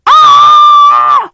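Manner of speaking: very high loud scream; trucks' scream. A narrator's reading. Trucks screaming as they roll downhill. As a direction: scream as you tumble. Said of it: "they cried".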